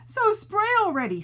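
high exasperated sputter. Acted. Oliver impatiently telling them to spray. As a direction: spit it out, fed up. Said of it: "sputtered Oliver".

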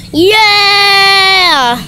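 long, drawn-out, high yeah; excited cheer. Real, not acted. Vlogger celebrating to the camera, excited cheer. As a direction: cheer it out and hold it.

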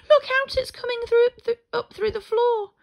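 high, loud cry of alarm; panicked warning. A narrator's reading. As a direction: shout the warning like frightened little animals. Animals panic as floorboard moves.

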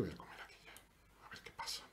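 hushed whisper to himself. Real, not acted. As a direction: mutter the move under breath. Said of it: "susurra su jugada".

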